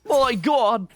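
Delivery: loud, high, drawn-out Aussie exclamation; mock Australian. Caster imitating wildlife videos, big high mock Australian accent. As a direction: marvel like an Australian wildlife host. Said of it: "how to do the accent".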